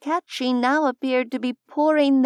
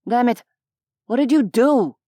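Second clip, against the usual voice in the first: louder, upset demand; angry yell; 4 dB louder than usual. A narrator's reading. Human sees his friend fallen, angry yell. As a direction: demand it angrily, hitting the words hard. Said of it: "the blue-haired human yelled".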